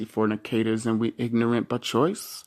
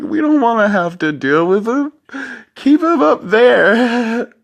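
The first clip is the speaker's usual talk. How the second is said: higher and louder mock sob, dragging out the last line slowly; hammed-up whiny plea, getting higher and louder; mock crying whine; 11 semitones higher, 7 dB louder than usual. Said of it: "sitting there crying like".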